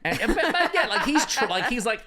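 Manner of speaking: breaking into laughter mid-sentence; laughing. Real, not acted. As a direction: giggle while trying to talk. Laughing while retelling.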